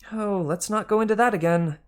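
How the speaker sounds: high exasperated female snake; Martha the snake. Acted. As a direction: roll your eyes at the old argument. Martha answering George.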